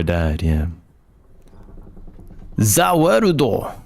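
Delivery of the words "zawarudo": high, slow, drawn-out catchphrase; catchphrase call. Acted. Player quoting a catchphrase at a game moment, calling it out. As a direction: stretch it out like an anime attack call.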